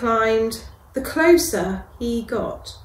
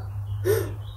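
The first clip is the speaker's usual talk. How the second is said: sharp unvoiced gasp of alarm; 9 semitones higher, 6 dB quieter than usual. Narrator gasping at the scary beast, unvoiced gasp. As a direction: catch your breath in fright. Mouse realises danger.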